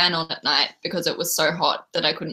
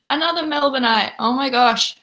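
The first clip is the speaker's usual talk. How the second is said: excited; 6 semitones higher, 4 dB louder than usual. Real, not acted. Another viewer from Melbourne.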